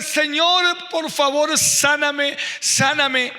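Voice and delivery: sick son crying out to God on his knees, loud; child's crying plea. Retold or imitated. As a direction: beg God for healing at full voice.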